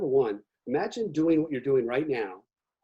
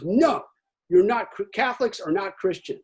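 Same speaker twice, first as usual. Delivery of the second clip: higher, louder mock driver flatly saying no; stern refusal; 6 semitones higher, 5 dB louder than usual. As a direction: voice the pious driver shutting him down. Driver refusing in retold story.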